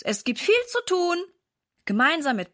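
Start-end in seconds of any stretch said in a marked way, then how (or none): 0.0-1.2 calling out. A narrator's reading. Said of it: "ruft er".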